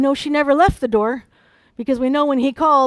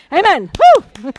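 loud, high whoop of praise; jubilant; 6 semitones higher, 12 dB louder, slower than usual. Real, not acted. Preacher rejoicing that her daughter is fine, jubilant shout. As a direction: burst out in real celebration.

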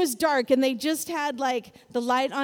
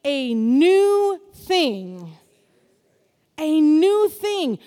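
slow, loud, drawn-out chant with the church; congregation call-and-response; 7 dB louder, much slower than usual. Real, not acted. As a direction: stretch every word out together. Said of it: "Say it with me".

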